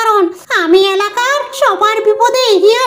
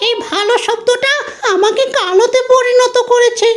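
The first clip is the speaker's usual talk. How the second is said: ordinary delivery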